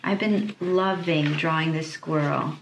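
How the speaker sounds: ordinary talk, nothing marked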